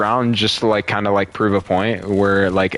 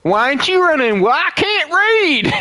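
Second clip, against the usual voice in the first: mock hillbilly. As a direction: play a clueless hillbilly. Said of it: "like that".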